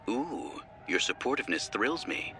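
soft, purring mock admiration; sultry teasing voice. A narrator's reading. Shar teases again.

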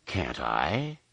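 low, soft, sneering retort; dripping sarcasm. A narrator's reading. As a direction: drip sarcasm on it. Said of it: "his voice dripping sarcasm".

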